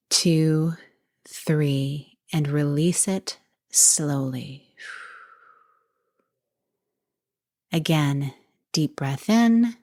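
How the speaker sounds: measured breathing guide with long pauses; guided meditation. Real, not acted. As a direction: count them gently through each breath, unhurried.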